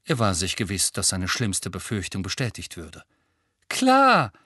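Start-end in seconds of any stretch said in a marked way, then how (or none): 3.7-4.3 bitter, much higher and louder. Acted. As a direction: spit it out bitterly. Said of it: "sagte Ron bitter".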